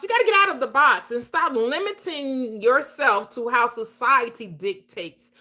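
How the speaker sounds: normal talking voice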